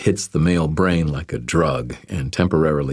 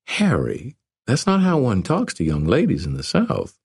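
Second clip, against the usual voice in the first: put-on higher, playful young woman; 7 semitones higher than usual. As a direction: tease him like a Southern belle.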